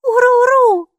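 put-on high, loud child's echo of the creature word; girl repeating. Майки, маленькая девочка, громко повторяет «Уруру» за Букой. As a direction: copy the strange word eagerly. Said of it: "повторила Майки".

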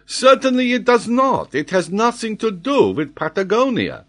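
put-on very high, loud French-accented denial; emphatic French geographer. Geographer denies any link to Patagonia, vehement. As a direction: contradict him with great vehemence. Paganel contradicts Glenarvan.

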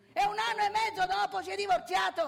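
loud, high, fast outburst; sfogo indignato. Acted. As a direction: blurt it out in scandalised fury. Annuncio indignato del divorzio. (sbotta indignato, forte e veloce)

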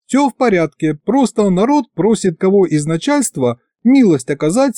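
eager to please the boss; lightly voiced servile imp; fawning imp. A narrator's reading. Imp explains the crowd.

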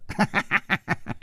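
put-on high, childlike cackle; giggling laugh. A narrator's reading. Stranger gloating over the beaten fighters, high giggle. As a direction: let out a thin, mocking giggle. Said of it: "咯咯的笑着说".

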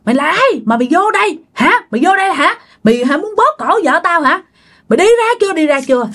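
retold angry cursing. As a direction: hurl abuse at the intruder. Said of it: "Ba chửi nha".

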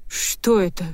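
faltering, bewildered question; a touch of stunned disbelief; stammered shock. A narrator's reading. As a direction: babble it in mild shock. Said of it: "пролепетал Зорин".

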